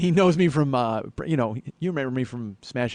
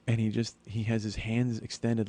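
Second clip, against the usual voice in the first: normal talking voice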